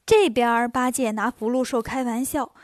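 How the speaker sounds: usual speaking voice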